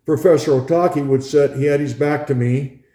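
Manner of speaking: usual speaking voice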